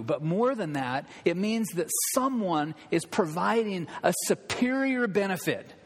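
ordinary talk, nothing marked

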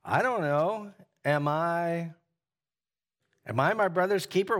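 dripping sarcasm. Acted. Teacher acting out Cain's answer to God, sarcastic. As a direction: play Cain being sarcastic with God. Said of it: "hear the sarcasm dripping off that".

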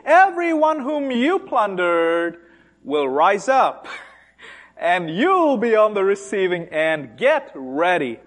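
ordinary talk, nothing marked

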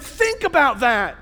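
ordinary delivery

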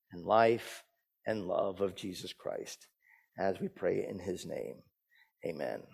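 soft, low, slowing prayer. Real, not acted. Pastor asks God to make old words new, prayer. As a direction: pray gently, settling down to a hushed Amen.

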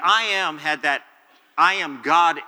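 "I am God" stated with full weight; emphatic I am. Shown as a demonstration. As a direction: lean hard on "I am God", declaring deity. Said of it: "emphasis on it".

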